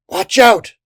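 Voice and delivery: high, loud, urgent warning; shouted warning. A narrator's reading. Sergeant warning the soldiers, high shout. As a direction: yell a sudden warning. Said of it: "the sergeant warned".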